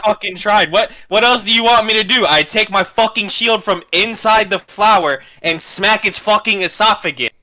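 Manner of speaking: loud, exasperated arguing; frustrated yelling. Real, not acted. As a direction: push back hard, fed up. Player argues with the GM.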